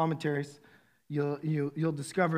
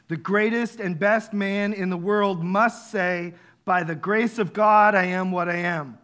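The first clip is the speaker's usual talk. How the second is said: quoted commentary; 6 dB louder than usual. Acted. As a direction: read the quote with gravity. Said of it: "Matthew Henry commentary, it says this".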